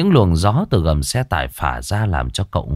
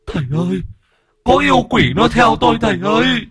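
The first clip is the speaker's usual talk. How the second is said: terrified wailing; 14 semitones higher, faster than usual. Acted. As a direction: scream in terror while being beaten. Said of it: "rồi gào khóc". (gào khóc hoảng loạn, rất cao)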